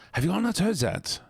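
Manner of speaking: high, disbelieving question; incredulous. Real, not acted.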